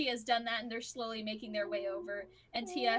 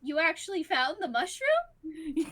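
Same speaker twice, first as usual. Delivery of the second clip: surprised; 5 semitones higher, 6 dB louder than usual. Acted. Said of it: "he looks surprised and he's like".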